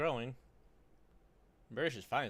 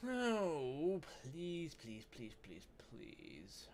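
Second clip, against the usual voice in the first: pleading fading to whisper; 5 semitones higher, much slower than usual. Real, not acted. Gamer pleading with his frozen game, fading to a whisper. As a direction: plead with the game until your voice fades out.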